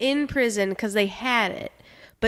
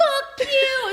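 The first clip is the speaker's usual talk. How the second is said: mother dog snapping. Retold or imitated. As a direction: snap at the pups as the mother dog. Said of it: "and she was like".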